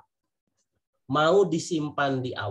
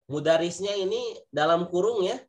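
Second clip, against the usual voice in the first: normal talking voice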